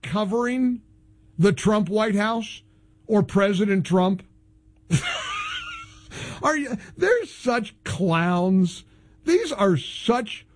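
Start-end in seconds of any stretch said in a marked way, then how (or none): 4.9-5.7 scornful laugh. Real, not acted. Host laughs at networks.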